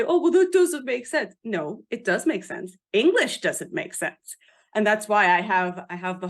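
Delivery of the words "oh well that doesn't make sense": high, loud parody of a doubter; mock objector. Author mimicking people who doubt Welsh spelling, mock objection. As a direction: imitate a clueless complainer, pitched up. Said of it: "Don't say".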